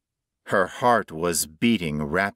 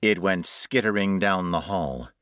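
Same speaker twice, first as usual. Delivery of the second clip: usual speaking voice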